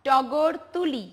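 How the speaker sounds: টগর তুলি said slowly and clearly; slow model sentence. Shown as a demonstration. Teacher slowly modelling a sentence for the class. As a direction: drawl out টগর তুলি as an example. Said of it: "যেমন টগর দিয়ে বলেছি না".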